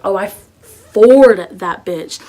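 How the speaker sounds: excited. Real, not acted. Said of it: "excited boast".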